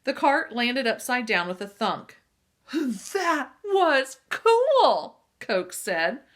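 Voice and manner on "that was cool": high, loud and drawn-out wonder; amazed. A narrator's reading. Coke amazed after the cart flips, high and loud. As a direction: stretch it out in awe. Boy amazed by exploding frisbee.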